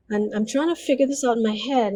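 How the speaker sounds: ordinary talk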